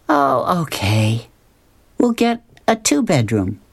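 put-on high teen girl voice, a little whiny; Luann's voice. Luann agreeing to share a room, high and resigned. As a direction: pitch it up as a resigned teenage girl. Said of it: "Luann says".

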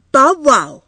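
put-on high, loud little-girl call; a small child calling out for the toy dog; toddler voice. A narrator's reading. Child to mother, calling out high and loud. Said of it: "repeated Edie".

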